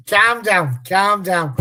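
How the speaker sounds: slow, high Scouse calm down, calm down; a laughing Scouse-accented calm down, calm down; Scouse catchphrase. Retold or imitated. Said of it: "I've gone Scouse now".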